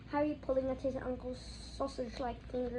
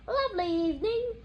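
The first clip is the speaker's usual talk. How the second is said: much higher, louder falsely cheery shout; 9 semitones higher, 7 dB louder than usual. A narrator's reading. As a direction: call it out to the neighbors with a forced grin. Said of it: "shouted Uncle Vernon".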